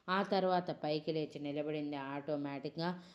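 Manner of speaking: ordinary delivery, nothing marked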